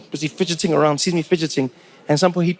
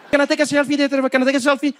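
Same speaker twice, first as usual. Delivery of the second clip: eager South African voice pitched a bit higher; South African seatmate; 7 semitones higher, faster than usual. Retold or imitated. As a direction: play the keen local guy asking for a selfie.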